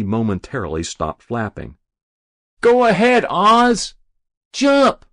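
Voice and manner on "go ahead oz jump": high, loud cheer of encouragement; egging-on shout. A narrator's reading. Friend in the crowd to the boy on the roof, shouting. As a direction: urge him on at full voice. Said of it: "shouted a lone word to the boy".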